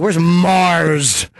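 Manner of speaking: sneering, drawn-out MARS. Retold or imitated. Host owning up to how he said Mars's name, sneering. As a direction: say the name like it's something foul. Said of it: "saying Mars's name with a sneer".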